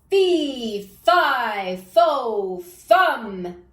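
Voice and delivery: loud booming giant voice; bawling giant. Acted. Giant bellowing down the beanstalk, loud booming voice. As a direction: boom each word like a huge giant. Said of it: "a horrible giant voice bawling".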